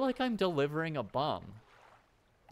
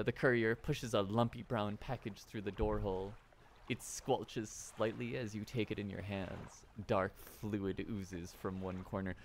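story narration; 3 semitones lower than usual. A narrator's reading. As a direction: set the scene like a storyteller. Reads game narration aloud.

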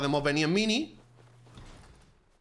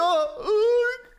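pained yelp; 5 dB louder than usual. Real, not acted. Hit by enemy.

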